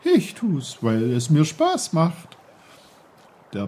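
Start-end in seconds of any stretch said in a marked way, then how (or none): 0.0-2.4 high, loud, bubbly babbling voice; a merry stream calling out; cheerful brook. Acted. The brook greets Peter loudly and cheerfully.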